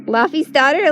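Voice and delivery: ordinary delivery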